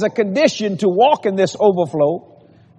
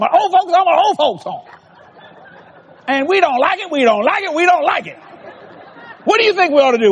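higher-pitched mock old lady, repeating each complaint; old lady speaking in threes; 6 semitones higher than usual. Preacher mimicking old lady upset about old folks home, higher repetitive voice. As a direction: imitate her grievances in rhythmic triplets. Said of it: "she talked like that".

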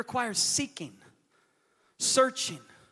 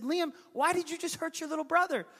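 high-pitched exasperated dad; frustrated parent retelling; 9 semitones higher than usual. Retold or imitated. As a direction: scold a child in frustration, pitched up. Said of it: "I was so frustrated".

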